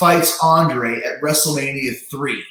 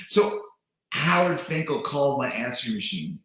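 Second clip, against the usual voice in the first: usual speaking voice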